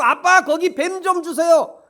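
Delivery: put-on child asking. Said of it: "그 아들이 잘못 알고".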